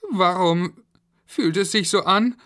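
put-on high, loud child voice; squeaky, anxious kid's chatter; worried children. A narrator's reading. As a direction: wonder aloud like a kid stuck in a lift. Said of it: "glaubte Florian".

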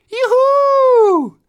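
very high, loud cheer. Acted. Kids cheer about the night picnic, loud and high. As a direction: squeal for joy.

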